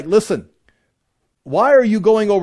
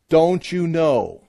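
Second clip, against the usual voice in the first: drawn out slowly, each word weighed; slow emphatic question; much slower than usual. Shown as a demonstration. Teacher quoting Paul's refrain to the class, slow and weighty. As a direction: let the question hang, slowly. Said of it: "He's gonna say it ten times".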